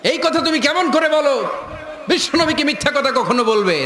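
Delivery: high-pitched angry shout; acted outraged scolding; furious father. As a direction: roar indignantly at a daughter, pitched high. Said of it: "রেগে মেগে আগুন".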